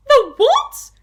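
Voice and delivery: very high, loud shriek of disbelief; horrified scream. Acted. Wife hearing of the giant skilly wiggler, horrified scream. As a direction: shriek the question in horror. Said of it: "screamed Missus Twit".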